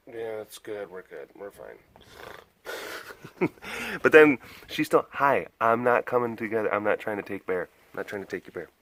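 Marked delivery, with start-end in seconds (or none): 0.1-1.8 softer and lower, fading to near nothing. Real, not acted. Reassuring his wife, fading much softer. 5.1-8.7 much softer, voicing the dog; soft pet voice. Voicing the dog about the bear toy, much softer.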